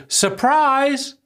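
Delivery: high, bright mock "Surprise!" at a party; party surprise shout. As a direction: yell it like friends jumping out at a birthday. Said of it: "we say".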